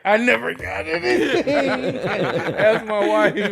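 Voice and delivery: loud, high laughter through the words; laughing. Real, not acted. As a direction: crack up while telling it. Laughing about wife's dog.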